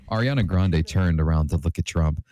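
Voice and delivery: plain reading voice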